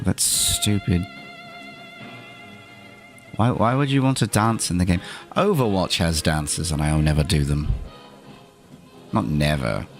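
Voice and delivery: ordinary delivery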